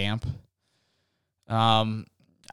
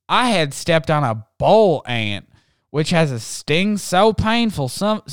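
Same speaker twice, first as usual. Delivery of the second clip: bright, raised reading-aloud voice, a touch louder; story reading; 5 semitones higher, 5 dB louder than usual. Acted. Host reading a listener's story to the audience, lively read-aloud voice.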